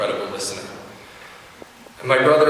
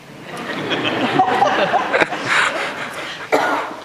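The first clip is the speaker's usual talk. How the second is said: long breathy laughter; laughing fondly at the memory; warm laugh. Real, not acted. Laughs at Kate story.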